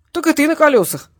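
ordinary delivery

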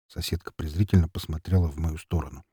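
ordinary delivery

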